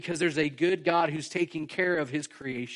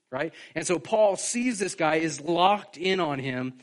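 normal talking voice

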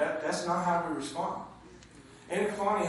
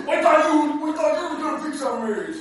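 complaining couple; 10 semitones higher, 8 dB louder than usual. Retold or imitated. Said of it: "couples complaining".

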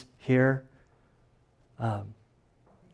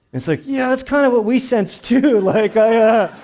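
excited laughing; 11 semitones higher, 8 dB louder than usual. Retold or imitated. Laughing retelling of sensing death.

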